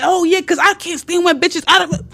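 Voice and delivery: high-pitched imitation of a man's outburst; mock yelling rant. Man throwing a fit to derail her, mock yelling. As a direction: mimic his screaming fit without full volume. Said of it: "he's doing all the yelling and screaming".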